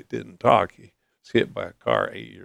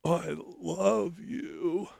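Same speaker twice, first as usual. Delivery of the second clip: higher, very slow, soft voicing of the roommate; roommate's voice; 9 semitones higher, 5 dB quieter, much slower than usual. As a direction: say it gently, like the boy who never spoke. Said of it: "He said".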